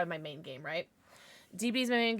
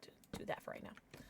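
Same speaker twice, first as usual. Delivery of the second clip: whispering; 19 dB quieter than usual. Real, not acted. Whispering while setting up.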